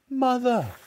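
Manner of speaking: high, loud horrified wail. A narrator's reading. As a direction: wail it out in dismay. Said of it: "wailed Missus Cranky".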